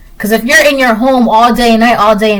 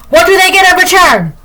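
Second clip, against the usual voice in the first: pitched up and louder, stressing do; 1 high emphatic repeat; 8 semitones higher, 4 dB louder than usual. Shown as a demonstration. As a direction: throw the question up high and loud. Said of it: "And what do they get in return?".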